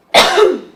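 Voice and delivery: loud forceful cough on request; one hard, strong cough; big strong cough. Real, not acted. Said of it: "can you give me a big, strong cough?".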